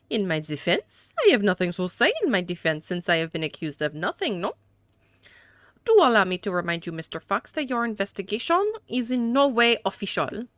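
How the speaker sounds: hammed-up French accent for a haughty suspect. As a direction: play a disdainful gentleman with a badly faked French accent. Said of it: "these atrocious accents".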